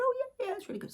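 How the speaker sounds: chirpy, over-the-top approval; mock enthusiasm. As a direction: squeal it like an eager fan. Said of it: "Other people said".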